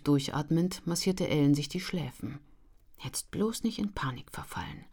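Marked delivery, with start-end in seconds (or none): 3.0-4.8 hushed, breathy self-reassurance; almost whispered, calming herself. A narrator's reading. Ellen whispers to herself to stay calm. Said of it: "versuchte sie sich zu beruhigen".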